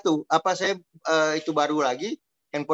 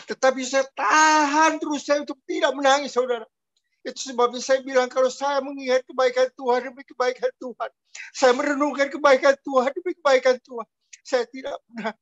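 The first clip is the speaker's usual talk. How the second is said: holding back tears; 5 semitones higher than usual. Real, not acted. Preacher to congregation, recalling God's goodness, holding back tears. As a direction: voice pitched up, straining not to cry.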